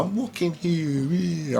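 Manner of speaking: high, soft New York cabbie imitation; New York accent. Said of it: "that New York accent".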